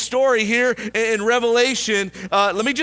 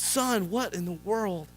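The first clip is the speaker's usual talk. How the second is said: much softer, slowed, bewildered father; hushed amazement; 9 dB quieter than usual. Retold or imitated. Father finds his son dancing in manure, soft bewildered hush. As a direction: ask it softly, as if you can't believe your eyes.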